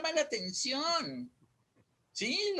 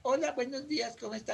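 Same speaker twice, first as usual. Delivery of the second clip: soft greeting that fades away; unprojected; 6 dB quieter, faster than usual. Acted. Imitating someone who won't project the greeting, too quiet. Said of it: "No, saca la voz".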